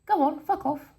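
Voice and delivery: high-pitched dismissive retort; acted, lightly exasperated send-off; mock brush-off. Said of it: "and I'm like this".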